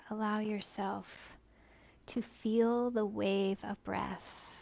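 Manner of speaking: slow, soft guided breathing voice; soothing near-whisper, unhurried; guided meditation. Acted. Guides breathing in child's pose.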